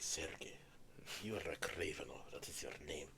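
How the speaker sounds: put-on low, soft Russian accent; Russian impression. Son imitating Kraven's father from the movie, Russian impression. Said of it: "Do your best Russian impression".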